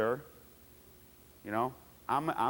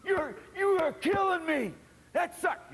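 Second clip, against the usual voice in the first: yelling at the ref; 14 semitones higher than usual. Retold or imitated. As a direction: scream that the call sucked. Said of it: "I want you".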